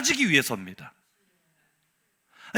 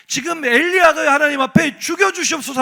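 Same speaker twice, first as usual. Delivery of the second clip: raised protest; 6 semitones higher, 6 dB louder than usual. Acted. Preacher's raised protest cry.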